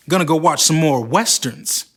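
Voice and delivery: usual speaking voice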